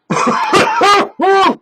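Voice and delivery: sudden harsh cough; coughing fit. Real, not acted. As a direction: cough from snuff up the nose. Hit the snuff too hard.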